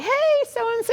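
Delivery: high, bright mock-friendly hello; imitating how agents used to greet each other warmly; cheery greeting. Old-time agents greeting a colleague, high friendly call.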